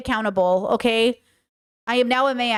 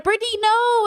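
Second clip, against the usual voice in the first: high mock plea; 10 semitones higher than usual. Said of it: "everyone was like".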